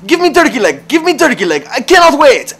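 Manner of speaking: very high, loud mock-Russian demand; yelling Russian accent. Customer refusing to wait a minute, yelled Russian accent. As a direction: escalate into a hammed-up Russian yell. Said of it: "this guy's, like, yelling at her".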